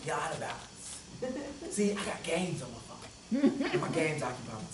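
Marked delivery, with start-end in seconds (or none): 0.1-0.6 low, soft, calm rebuke; gentle voice of God. Retold or imitated. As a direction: speak it quietly as God. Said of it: "hear him say".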